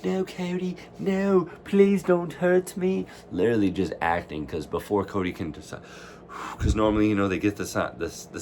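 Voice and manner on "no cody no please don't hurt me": high, slow fake begging; mock pleading. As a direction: overplay a cowardly plea. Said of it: "He's literally like".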